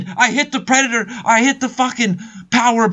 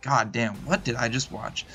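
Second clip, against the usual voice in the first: quiet stunned quote; 10 semitones lower, 10 dB quieter than usual. Retold or imitated. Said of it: "Thresh is like".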